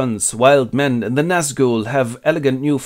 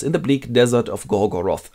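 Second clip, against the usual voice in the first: ordinary talk, nothing marked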